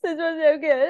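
high, gushing mock fan voice; gushing fan review. Her own Letterboxd review read as a starstruck, high gush. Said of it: "Me on Letterboxd".

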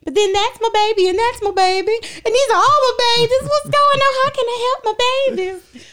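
high, loud cooing mama voice; doting mother voice. Retold or imitated. Voice teacher doting on crying students, cooing high. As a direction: coo over them like a mother hen. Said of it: "And I was just like".

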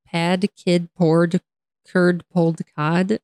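tongue twister one word at a time; careful, limited speed. Shown as a demonstration. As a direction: pick through each word separately and evenly. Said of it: "could not say it faster than that".

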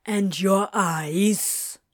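very slow, pointed question; pressing. A narrator's reading. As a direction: press the point, drawing out each word. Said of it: "Crowley pressed".

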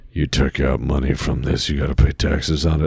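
low, stern tax man; deep official voice. Retold or imitated.

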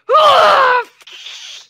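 very loud, high exploding whoosh; huge explosive whoosh. Shown as a demonstration. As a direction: blast out a big noisy burst. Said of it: "It's like".

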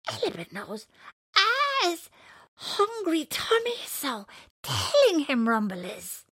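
high, loud, slow bird voice; cartoon bird voice. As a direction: squeak it like a little bird. Bird character speaks.

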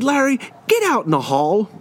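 high-pitched teacher command, not shouted; retold stern order. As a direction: act out sending a pupil out. Teacher orders student out of room.

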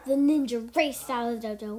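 usual speaking voice

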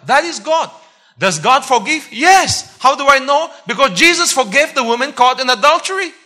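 high, loud rapid-fire question and answer; fervent preaching. Real, not acted. Preacher assuring the congregation that God forgives, fervent proclamation. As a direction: proclaim it at full voice.

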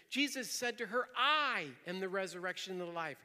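"I" punched loud and held; stress on I. Shown as a demonstration. Preacher showing the memorizing trick, stressing "I". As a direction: hit the word "I" hard, then fall away. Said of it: "Alternate emphasizing each word".

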